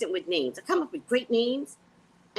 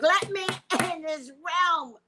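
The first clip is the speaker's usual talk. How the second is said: mock pleading; 7 semitones higher than usual. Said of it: "I was like".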